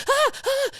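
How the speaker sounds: imitated panicked squeal; mock frightened squeal. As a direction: shriek like a spooked friend. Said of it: "she's like".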